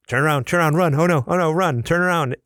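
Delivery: high, loud mock-panic yell; panicked warning. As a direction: urgently call out a warning, acted. Acts out wives' panicked warning.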